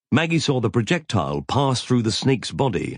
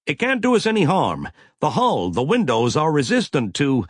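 sharp, higher command; snapped; 7 semitones higher than usual. A narrator's reading. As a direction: snap it out to calm the room. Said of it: "snapped Nathan Boss".

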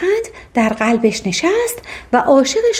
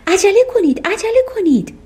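high-pitched, urgent command; urgent urging; 10 semitones higher than usual. A narrator's reading. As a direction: hurry them along in a raised pitch. Urging servants to hurry. (با لحنی عجولانه و زیر)

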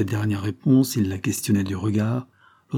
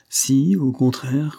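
slow, higher, emphatic retort; brusque, heated; 4 semitones higher, 3 dB louder, much slower than usual. A narrator's reading. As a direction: press it out slowly with some heat. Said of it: "Il parlait avec brusquerie, presque avec emportement". (avec brusquerie et un peu d'emportement)

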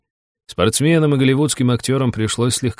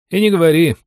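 raised, louder grunt of agreement; хмыкнуть в тон; 9 semitones higher, 6 dB louder than usual. A narrator's reading. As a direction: agree with a voiced huff. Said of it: "в тон мне хмыкнул Серёга". (хмыкнуть, соглашаясь)

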